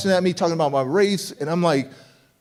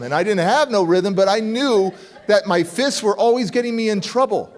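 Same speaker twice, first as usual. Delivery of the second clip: normal talking voice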